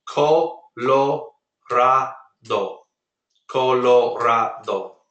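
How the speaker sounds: "Colorado" said the Spanish way, slow, with a tapped r and pure vowels. Shown as a demonstration. Teacher modelling Spanish pronunciation for students, slow Spanish "Colorado". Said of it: "But in Spanish, very simple".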